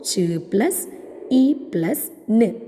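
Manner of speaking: slow ch, E, n as "chuh, ih, nnh"; sounding out chin. Shown as a demonstration. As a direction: sound out ch, E, n slowly, one by one. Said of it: "That together give you the word". (ch, E, n "ച്, ഇ, ന്" എന്ന് പതുക്കെ)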